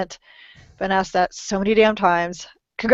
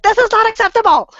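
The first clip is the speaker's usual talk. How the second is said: high, loud indignant cry; hammed-up outrage, pitched high; mock outrage; 12 semitones higher, 6 dB louder than usual. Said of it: "I'm like".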